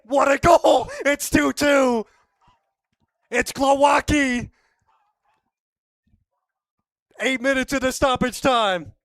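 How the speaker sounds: roaring, high, sustained commentator yell; goal shout. Real, not acted. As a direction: keep screaming the goal. Goal celebration call.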